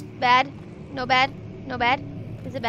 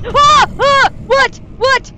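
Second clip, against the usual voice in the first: gasp, then high, loud shrieks of fright; startled screams; 11 semitones higher, 15 dB louder, slower than usual. Real, not acted. Streamer jump-scared by the game, shrieking. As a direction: jump out of your skin.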